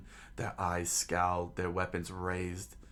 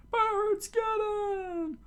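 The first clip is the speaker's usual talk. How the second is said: very high, loud falsetto war cry; rally the mob in a strained squeal; crowd charging; 5 dB louder, slower than usual. A narrator's reading. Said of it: "the crowd charged out loud".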